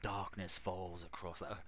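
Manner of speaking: low, quiet, spooky horror narrator; Vincent Price. Retold or imitated. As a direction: try a sinister horror movie narrator voice. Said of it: "I don't think I can do Vincent Price".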